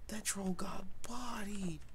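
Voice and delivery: slow, amazed whisper. Real, not acted. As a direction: breathe it out in awe. Whispered after the win.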